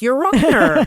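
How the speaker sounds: high, loud, indignant Your Honor; whiny high protest. Retold or imitated. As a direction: squeal it like you can't believe it. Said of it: "He goes".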